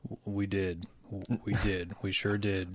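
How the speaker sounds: a deep, mannered nerd voice; Snowden voice. Acted. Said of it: "are you doing your Snowden voice?".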